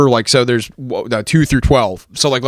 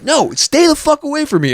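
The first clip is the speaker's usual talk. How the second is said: much higher indignant yell; retold outburst at the bum, much higher; mock angry yell; 14 semitones higher than usual. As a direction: shoo the bum away, pitched way up. Cussing out the bum.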